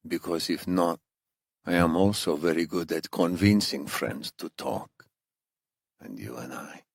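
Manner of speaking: put-on quiet, courteous threat, thick Iranian accent; Iranian interrogator, coolly menacing. Narrator voices the accented captor.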